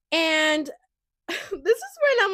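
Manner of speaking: talking through laughter; laughing. Real, not acted. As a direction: laugh as you set it up.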